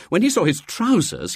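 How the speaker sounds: usual speaking voice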